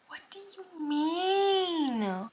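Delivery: high, drawn-out wail; dramatic disbelief. Real, not acted. Reader learning characters are her age, wailing in disbelief. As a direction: stretch it into an incredulous cry.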